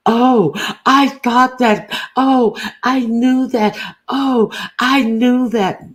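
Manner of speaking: high, slow sing-song mock voice; mocking, high and drawn out; mock dumb realization. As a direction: drag out each oh like a fake airhead. Mocking someone's fake realization.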